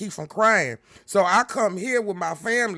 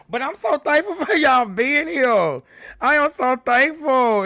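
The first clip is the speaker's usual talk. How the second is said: excited gratitude; 6 semitones higher, 6 dB louder than usual. Real, not acted. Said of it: "thanking viewers".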